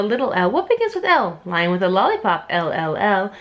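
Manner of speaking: bouncy, rhythmic ABC read-aloud; reading to children. Acted. Reading Doctor Seuss ABCs to a child, sing-song chant. Reads alphabet book aloud.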